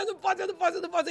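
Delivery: high, repeated acted-out protest; panicked protest. Telling God she cannot go on, high panicked protest.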